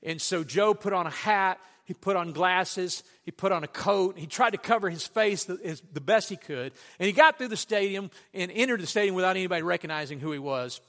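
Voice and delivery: ordinary delivery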